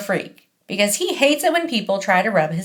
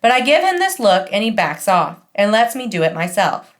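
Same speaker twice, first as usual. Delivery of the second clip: usual speaking voice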